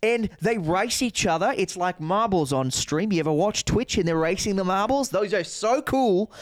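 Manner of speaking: keen Aussie hustler, pitch often climbing; Australian sales pitch. Acted. Comic pitching fake horse racing to a friend, Australian accent. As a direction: sell it eagerly in an Australian accent. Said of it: "That's exactly what he fucking did".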